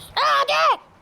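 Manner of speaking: high shouted اهدي; shouted calm down. Shown as a demonstration. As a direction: yell اهدي high and hard. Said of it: "اهدي يا بختاش".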